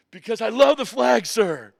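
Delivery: high, very loud retold answer; impassioned recruit. Recruit answers the drill instructor, passionate shout.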